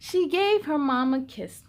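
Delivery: ordinary delivery, nothing marked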